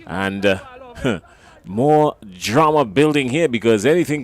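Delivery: brisk, tense play-by-play; live commentary. Real, not acted. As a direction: call the closing overs with rising drama.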